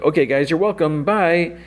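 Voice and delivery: a chirpy wish-granter shooing them out; high Prismo voice. Retold or imitated. Prismo sends his visitors off in a high, breezy voice.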